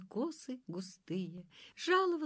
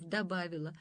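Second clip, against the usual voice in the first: ordinary delivery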